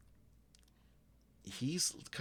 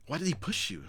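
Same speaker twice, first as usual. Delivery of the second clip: higher, louder indignant question; a little angry; 9 semitones higher, 5 dB louder, faster than usual. Acted. Pollux asking about the push, a little angry. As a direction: ask with a touch of outrage. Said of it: "Pollux actually looks a little angry".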